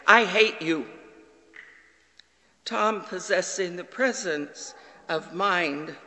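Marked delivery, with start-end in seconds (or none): 0.0-0.9 put-on louder, higher shouted child's outburst; frustrated child. Boy yelling at his father at bedtime, angry. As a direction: voice a six-year-old yelling at his dad. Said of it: "became so frustrated that he said".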